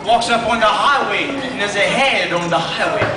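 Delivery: normal talking voice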